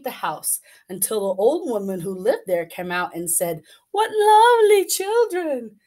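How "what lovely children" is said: high, loud sugary crone; sweet witch voice. Acted. Witch luring Hansel and Gretel, high and sugary. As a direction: lure the children in like a witch.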